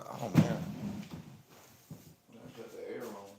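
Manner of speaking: normal talking voice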